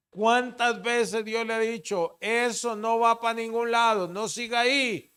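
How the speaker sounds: "¿Cuántas veces Dios le ha dicho" hurled out high and loud; loud repeat. Shown as a demonstration. Pastor pressing the congregation, loud and high.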